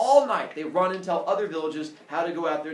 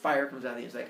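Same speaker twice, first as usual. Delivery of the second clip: normal talking voice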